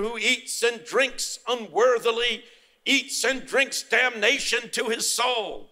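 high, slow, drawn-out pulpit imitation; condemning minister. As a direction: mimic a minister laying it on thick. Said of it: "the minister was condemning people".

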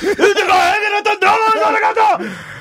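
hammed-up wailing, high and drawn out; mock his crying with slow, shouted sobs; mock crying samurai. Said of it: "Samurais don't cry like that".

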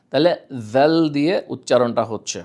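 normal talking voice

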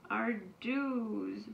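hammed-up blubbering; a playful boo-hoo for the kids. A narrator's reading. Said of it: "boo-hooed Ava's mother".

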